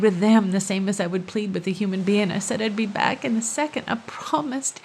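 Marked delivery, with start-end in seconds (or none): none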